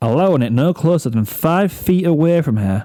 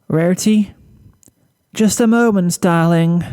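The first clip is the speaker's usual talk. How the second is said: much higher, put-on cheerful lilt; forced brightness; 6 semitones higher than usual. Rarity to Fluttershy, masking worry with bright, higher lilt. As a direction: fake a sunny tone. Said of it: "muster as much of a bright attitude".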